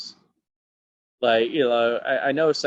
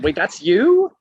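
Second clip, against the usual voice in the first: much higher, louder mock disbelief; disbelieving; 9 semitones higher, 9 dB louder, slower than usual. Cheerleader doubting he is the actor, loud high disbelief. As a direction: hit the you as if it can't be true.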